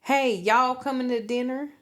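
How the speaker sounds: put-on a cute Southern toddler calling to dinner; Southern accent. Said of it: "the Southern accent".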